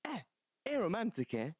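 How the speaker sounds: a hint of a Nigerian accent, scandalised and pleading; Nigerian mum impression. Podcast host plays Angie's mum hearing she's aromantic, mock Nigerian accent. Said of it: "my impression of Angie's mum reacting".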